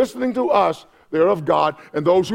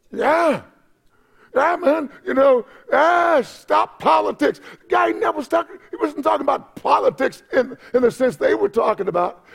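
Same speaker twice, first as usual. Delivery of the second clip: full-force preaching; 8 semitones higher than usual. Acted. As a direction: let it ring out to the church.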